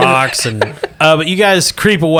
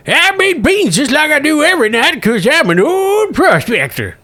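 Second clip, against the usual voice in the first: put-on high-pitched old prospector; 12 semitones higher than usual. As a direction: play it as a folksy old prospector. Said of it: "He's like".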